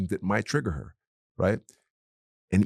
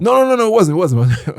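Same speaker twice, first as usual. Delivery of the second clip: much higher, louder, laughing protest; passionate denial; 10 semitones higher, 9 dB louder than usual. Real, not acted. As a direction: jump in loud and high to deny it. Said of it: "I'm passionate right now".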